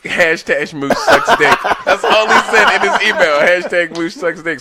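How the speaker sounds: loud and high, cracking up while reading; laughing through it. Real, not acted. Host reading a listener's crude email aloud, laughing loudly. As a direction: laugh out the hashtag, barely holding it.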